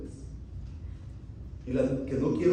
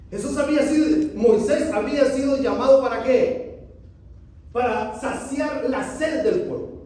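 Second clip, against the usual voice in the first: louder, higher, driving sermon voice; full-force preaching; 11 semitones higher, 7 dB louder than usual. Acted. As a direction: preach it hard to the congregation. (predicación enérgica y en voz alta)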